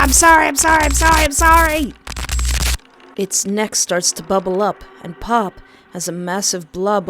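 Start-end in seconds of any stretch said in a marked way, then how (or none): 0.0-1.9 loud, high, frantic crying sorry; panicked apology. Real, not acted. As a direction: beg for mercy in panic.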